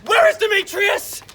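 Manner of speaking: high, loud demand; furious shout. Acted. Enchanted Lysander demands where his rival is, furious shout. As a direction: roar the rival's name.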